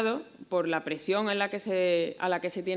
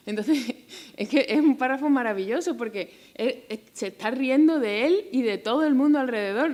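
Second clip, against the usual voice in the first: laughing delight; 6 semitones higher, 6 dB louder than usual. Real, not acted. Laughing at the author's irony.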